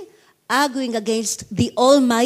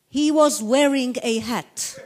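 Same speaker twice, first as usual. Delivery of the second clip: put-on Yiddish accent; 4 semitones higher, slower than usual. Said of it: "Granny looks up to heaven and says".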